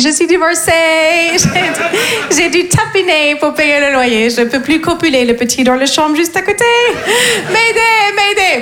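French in a hammed-up English accent, high-pitched. Divorcée trying to sound cool on a date, English accent. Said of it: "parler français avec un accent anglais".

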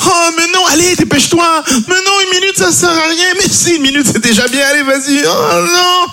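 high desperate mock wife wail; pleading wife. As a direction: beg in a high whining voice. Wife begging on landing.

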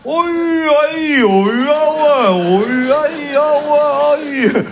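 put-on high, drawn-out drunken vowels; drunk vowel babble. Shown as a demonstration. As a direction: slur only the vowels like a drunk. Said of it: "Ça s'appelle le voyelle". (charabia d'ivrogne tout en voyelles, aigu et traîné)

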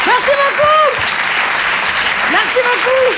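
very high mock-female merci; falsetto thanks. A comic thanking the crowd in a squeaky falsetto. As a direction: squeak out a dainty thank you.

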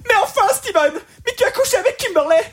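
high hammed-up wailing girl; crying American girlfriend. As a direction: squeal it like a soap opera heroine. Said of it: "La fille pleure, elle arrive, elle fait".

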